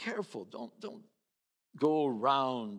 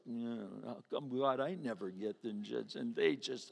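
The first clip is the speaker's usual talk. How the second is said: softer, lower self-pitying grumble; envious grumble; 5 semitones lower, 5 dB quieter than usual. Retold or imitated. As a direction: complain under your breath. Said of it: "in your heart, you walk away and you go".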